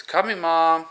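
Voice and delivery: sing-song answer to mom; sweet teen reply. Retold or imitated. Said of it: "he goes".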